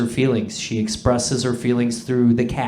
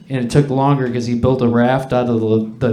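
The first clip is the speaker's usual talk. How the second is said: ordinary talk, nothing marked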